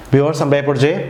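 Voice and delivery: ordinary delivery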